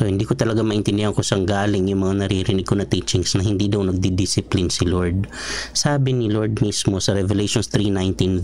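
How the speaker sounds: ordinary talk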